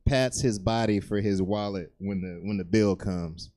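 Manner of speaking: ordinary talk, nothing marked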